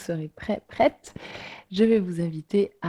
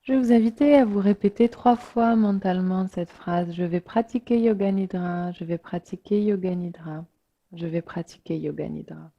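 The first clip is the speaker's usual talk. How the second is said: unhurried meditation voice with long pauses; guided relaxation. Real, not acted. Yoga teacher inviting listeners to let the body sink, guided relaxation. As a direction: guide listeners gently into rest, letting phrases settle.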